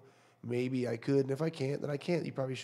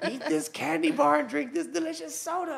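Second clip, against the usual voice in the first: devil voice; 6 dB louder, much slower than usual. Retold or imitated. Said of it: "He goes".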